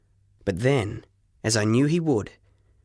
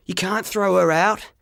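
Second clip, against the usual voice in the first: higher, urgent plea; boy pleading with the shopkeeper, raised voice; pleading yell; 7 semitones higher than usual. A narrator's reading. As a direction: beg him not to throw her out. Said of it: "I yelled".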